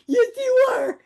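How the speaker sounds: put-on high cooing baby voice; baby talk. Cooing at the angry shopper, high baby talk. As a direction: coo it like to a toddler.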